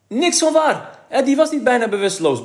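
put-on a boy crying out against a false story; indignant outburst. A narrator's reading. Kees objecting to the newspaper story, very high and loud. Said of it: "bromde Kees".

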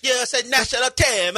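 hammed-up Italian; mock Italian accent. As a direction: squeal it like an Italian commentator. Mocking Italian broadcast accent.

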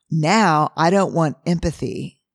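normal talking voice

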